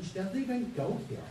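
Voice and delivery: lightly voiced kid piping up; a hint of a kid's voice; kid calling out. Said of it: "a kid going".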